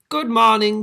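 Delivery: high, loud cheerful greeting; calling out. Acted. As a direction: call across the woods. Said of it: "she called".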